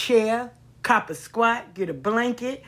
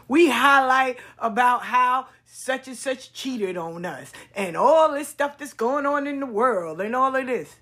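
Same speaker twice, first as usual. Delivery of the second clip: ordinary talk, nothing marked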